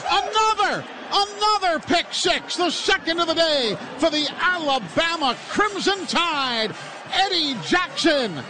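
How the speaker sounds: shouting the big play high and loud; excited play call. Real, not acted. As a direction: call it like the stadium just erupted. Interception return touchdown call.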